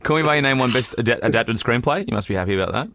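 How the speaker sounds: ordinary delivery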